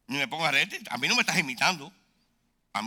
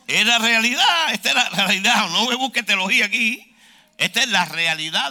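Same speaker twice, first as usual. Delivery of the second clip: loud, raised preaching voice; forceful, high-energy exclamation; emphatic insistence; 5 semitones higher, 10 dB louder than usual. Real, not acted. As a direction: hammer it home at full volume.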